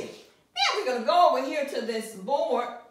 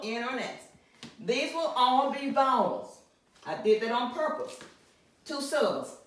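usual speaking voice

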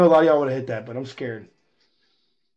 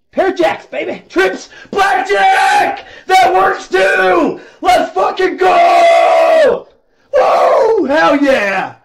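high excited rooting bursting into screams; real jackpot elation; ecstatic shouting; 11 semitones higher, 5 dB louder, slower than usual. Real, not acted. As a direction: build to a loud, drawn-out victory yell. Rooting, then hitting blackjack.

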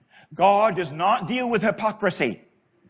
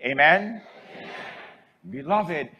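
ordinary talk

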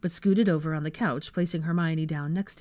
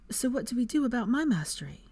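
higher-pitched woman's character voice; questioning young witch; 7 semitones higher than usual. A narrator's reading. Hermione asking about her mastery, questioning. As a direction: lift the pitch into a concerned question.

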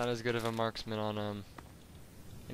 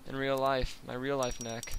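ordinary delivery

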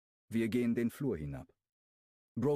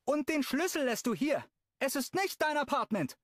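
much higher, louder call down the hall; calling after him; 4 dB louder than usual. Acted. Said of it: "ruft Brooklyn ihm hinterher". (laut hinterhergerufen)

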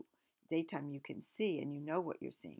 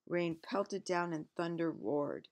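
ordinary delivery

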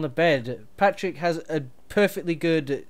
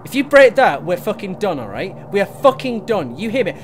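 louder and higher, furious warning; angry threat; 4 semitones higher, 10 dB louder than usual. Acted. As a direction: warn him not to break it. Threatening to end the partnership.

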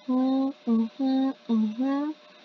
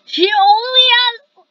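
high, loud shocked cry; 9 semitones higher, 14 dB louder than usual. Real, not acted. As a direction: gasp it out in shock.

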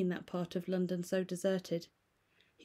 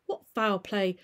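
frightened irritation; 2 semitones higher, 10 dB louder than usual. Acted. Lawyer pressing the butler, frightened and irritated. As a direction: snap it out, scared and cross. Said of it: "a good deal frightened and rather inclined to be irritated".

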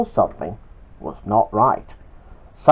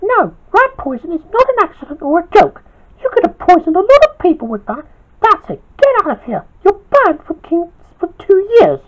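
much higher, louder boss banning him; angry yell; 5 dB louder than usual. Acted. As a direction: lay down the punishment firmly. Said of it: "yelled Queenie".

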